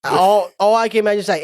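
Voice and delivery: ordinary delivery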